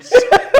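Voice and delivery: high, loud burst of laughter. Real, not acted. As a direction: crack up at your own story. Laughing at his pencil story.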